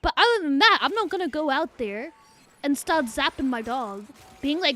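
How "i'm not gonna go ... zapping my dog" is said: usual speaking voice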